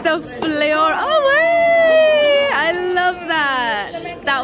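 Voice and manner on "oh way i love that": high, loud, drawn-out squeal; excited squeal. Real, not acted. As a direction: squeal with delight. Recalling flamenco class.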